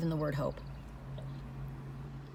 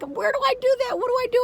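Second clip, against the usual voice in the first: much higher, bewildered squeal; flustered; 14 semitones higher, 4 dB louder, faster than usual. Retold or imitated. Confused by Instagram, squeaking in mock panic. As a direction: act out fumbling with the app. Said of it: "I'm like".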